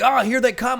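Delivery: high and loud, pointing upward in awe; thrilled lookout shout; excited high cry. Retold or imitated. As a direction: call out the arrival like you can't believe it. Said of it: "they're like".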